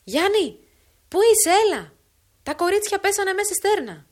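loud, high alarm call; woman calling for the father, loud and alarmed; frantic cry. Acted. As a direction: shout the news of the drowned girls across the fields. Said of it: "επανέλαβε την κραυγή της".

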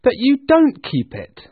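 high, loud objection; protesting nephew. Acted. Nephew objecting to his uncle, astonished. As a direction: exclaim it in disbelief. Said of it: "Scrooge's nephew".

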